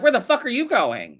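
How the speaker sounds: a hint of jealous friends calling out; mock jealous friends. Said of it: "And they were like".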